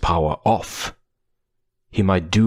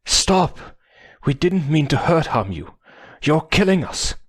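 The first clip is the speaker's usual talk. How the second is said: desperate pleading; 10 semitones higher than usual. Acted. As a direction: beg them to stop. Dying Toa pleading.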